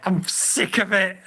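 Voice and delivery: ordinary talk, nothing marked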